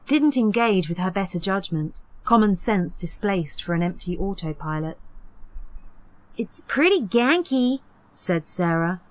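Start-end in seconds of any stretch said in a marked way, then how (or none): 6.4-7.8 higher, fake casual remark; insincere. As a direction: say it with nothing genuine. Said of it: "nothing genuine about her tone".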